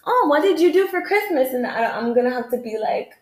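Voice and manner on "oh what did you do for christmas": high-pitched imitation of a curious acquaintance; mock small talk. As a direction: chirp the polite holiday question. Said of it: "environment where it's like".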